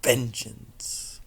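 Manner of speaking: breathy menacing whisper; hissing shadow self; eerie shadow whisper. Acted. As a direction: whisper like a dark inner voice. Shadow voice taunting Luna.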